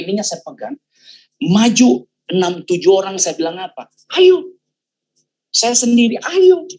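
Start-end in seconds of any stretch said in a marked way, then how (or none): 4.2-4.6 high, loud come on, daring them; challenging shout. Retold or imitated. Facing six or seven men alone, a loud challenging shout. 6.2-6.7 high, loud come on, daring them; challenging shout. Retold or imitated. Facing six or seven men alone, a loud challenging shout.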